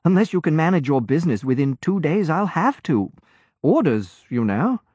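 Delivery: put-on an obliging young sailor, easy and sure; cheerful young lieutenant. A narrator's reading. Lieutenant explains orders.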